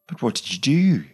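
ask, amazed; in wonder. A narrator's reading. Graham, amazed, asks Claire what she did. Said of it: "he asked in wonder".

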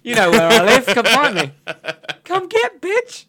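high, cocky goading taunt; challenging them with a grin; mock taunt. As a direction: dare them to come find you. Taunting the villagers in play.

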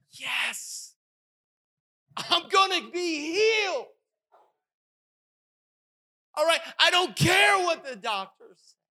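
high, loud exultant shout; triumphant shout. Retold or imitated. Believer celebrating a healing that God never promised, shouting. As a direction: yell it like you've already won. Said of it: "I'll tell you what we think".

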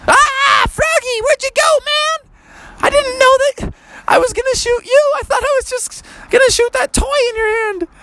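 very high, frantic call for a lost friend; high kid voice. Acted. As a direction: squeak out the panic. Boy shouting for shrunk friend.

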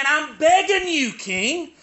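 high, urgent preacher's plea; impassioned begging. Acted. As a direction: beg at full pitch, stretching the key words. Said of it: "can you see the man Jeremiah".

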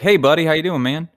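lightly voiced cheery friend; friendly buddy greeting. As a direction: greet like an old pal. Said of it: "if they're like".

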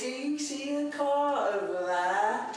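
high, slow hammed-up Cockney child; mock Cockney accent. Parent mimicking son asking about a car, silly Cockney accent. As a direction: stretch it out in exaggerated high Cockney. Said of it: "I didn't realize he was Cockney".

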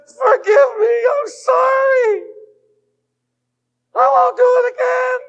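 put-on a child crying out to be forgiven; pleading child's shout. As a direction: shout the apology like a desperate child. Child begging parents for forgiveness.

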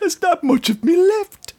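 high, strained put-on voice; Saw Gerrera impression. Mocking the Saw Gerrera line, high strained voice. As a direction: wheeze it like a dying rebel.

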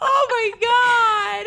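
laugh-crying shout. Real, not acted. Overwhelmed laughing reaction.

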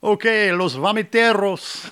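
high, slow, loud hammed-up Spanish 'los vomiteros'; Spanish accent. Host jokingly naming the podcast fans in a hammed-up Spanish accent. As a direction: roll it out like a game-show host in Spanish. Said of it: "You'll never be able to say".

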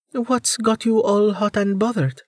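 high, mock-innocent female character voice; feigned innocence, teasing and high; false innocence. A narrator's reading. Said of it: "with false innocence".

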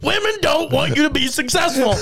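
high, hammed-up mock rant; ranting impression. Roommate ranting about women, high mock preaching. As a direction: declaim it like an overexcited lecturer.

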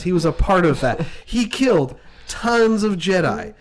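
ordinary talk

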